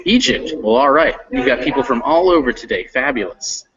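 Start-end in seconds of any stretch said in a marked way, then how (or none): none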